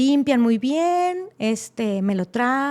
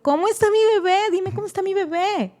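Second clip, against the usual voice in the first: anxious pleading; 8 semitones higher, 3 dB louder than usual. Retold or imitated. Mother demanding about baby.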